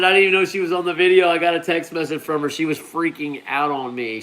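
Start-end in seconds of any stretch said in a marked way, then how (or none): none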